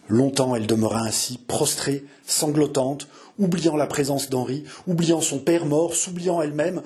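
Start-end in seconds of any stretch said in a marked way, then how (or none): none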